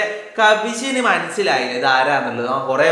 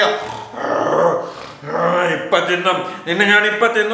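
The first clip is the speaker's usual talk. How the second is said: lion thinking; slower than usual. Acted. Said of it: "എന്നൊക്കെ വിചാരിച്ചിട്ട് ഇങ്ങനെ സിംഹം".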